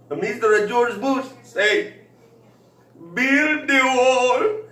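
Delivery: high, slow mock Mexican accent. Drunk boss tells Ro about the wall, high and slow in a Mexican accent. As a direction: ham up the boss's accent, drawn out. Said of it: "He says".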